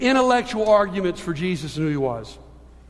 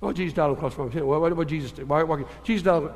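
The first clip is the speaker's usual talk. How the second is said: fast, low, softer mindless run-through; offhand rattling; 5 semitones lower, 4 dB quieter, much faster than usual. Retold or imitated. Churchgoers just going through a familiar creed, rattled off carelessly. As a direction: race through it, trailing into careless babble. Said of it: "we just sort of go through it".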